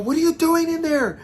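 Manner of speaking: very high, loud retold squeal; screechy acted-out question; startled demand. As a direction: imitate a shocked friend, voice shooting up. Startled friends confront each other.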